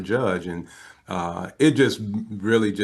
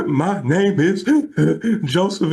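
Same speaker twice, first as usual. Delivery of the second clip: high, loud mock sobbing, broken by gasps; bawling; 9 semitones higher, 6 dB louder, slower than usual. Tearful caller introducing himself, imitated bawling. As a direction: blubber the introduction haltingly. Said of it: "And I am bawling".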